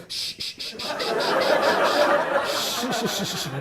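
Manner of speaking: soft hushing into someone's ear; mock-soothing string of shushes; repeated shushing. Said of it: "I'm just going in his ear".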